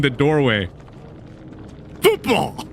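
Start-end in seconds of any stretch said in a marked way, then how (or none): 2.0-2.7 excited exclaim. Real, not acted. Shouting the joke word.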